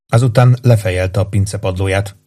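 ordinary delivery

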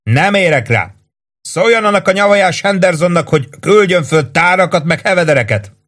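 high, pressed shout over the gunfire. A narrator's reading. Sergeant shouting from the roof under fire. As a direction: yell it over the noise. Warden shouting during air raid.

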